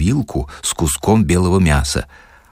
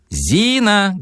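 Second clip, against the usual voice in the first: much higher shout across the flat; calling out; 13 semitones higher than usual. Acted. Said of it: "кричал Барменталь". (Борменталь зовёт Зину, гораздо выше)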